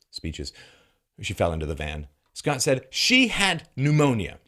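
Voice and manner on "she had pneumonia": slow, high and emphatic mock assertion; each word leaned on heavily; stated as fact. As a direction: mimic someone declaring it as settled fact. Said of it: "Like it's a fact".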